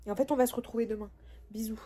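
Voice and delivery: quick hushed goodbye whisper; quick whispered sign-off to viewers. Real, not acted. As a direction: breathe it out fast like a secret sign-off. Signing off the vlog softly.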